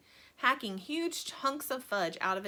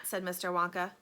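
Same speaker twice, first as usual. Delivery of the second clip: usual speaking voice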